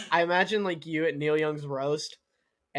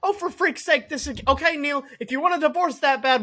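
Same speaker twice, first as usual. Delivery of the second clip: exasperated; 10 semitones higher, 4 dB louder than usual. Retold or imitated. Wife exasperated.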